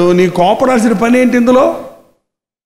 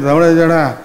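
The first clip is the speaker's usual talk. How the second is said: sullen disappointment; 5 semitones lower than usual. Retold or imitated. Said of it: "మొహం ఏందో మెసకపోయింది".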